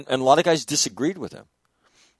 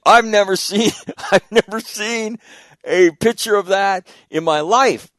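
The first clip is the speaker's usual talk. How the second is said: high, laughing through the quote; a retold rant broken by laughter; laughing retelling; 6 semitones higher, 4 dB louder than usual. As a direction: retell it cracking up. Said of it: "And he says".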